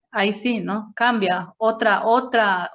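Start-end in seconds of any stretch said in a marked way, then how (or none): none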